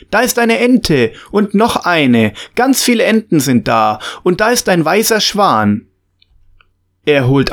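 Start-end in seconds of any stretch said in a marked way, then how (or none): 0.1-5.9 lightly voiced eager boy, higher; a boy calling out with delight; excited child calling out. A narrator's reading. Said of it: "Alfred ruft".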